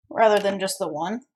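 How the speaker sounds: ordinary talk, nothing marked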